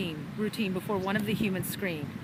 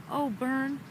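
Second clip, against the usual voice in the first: murmur; 4 semitones higher, 6 dB quieter than usual. A narrator's reading. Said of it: "Fleur murmured".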